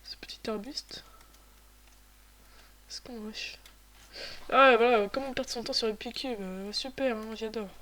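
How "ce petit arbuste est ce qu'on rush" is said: murmure lent sans voix; chuchoté. Real, not acted. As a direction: en planque, tout bas. Se cache, chuchote. (chuchotement discret)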